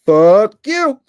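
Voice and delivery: loud, high mock outburst; angry heckler. People rejecting Kamala's speech, loud mock anger. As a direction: blurt it out as an outraged refusal. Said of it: "people would be like".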